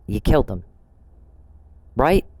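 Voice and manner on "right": RIGHT lifted into a pointed question. Real, not acted. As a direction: put a rising question mark on "Right". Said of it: "I'm asking as a question".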